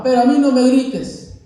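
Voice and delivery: loud, high mock scolding; imitating a shouting father; father yelling. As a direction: snap at the child as an angry dad. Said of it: "le dice el papá".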